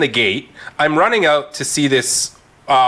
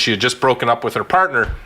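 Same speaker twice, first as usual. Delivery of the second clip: ordinary delivery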